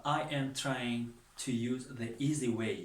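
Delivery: every English word set apart, very slow and clear; slow simple English. Shown as a demonstration. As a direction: speak it slowly for beginners to follow. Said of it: "ساده ترین راه استفاده برم".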